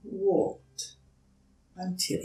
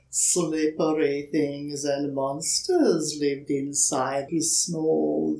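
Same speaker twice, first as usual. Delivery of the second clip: plain reading voice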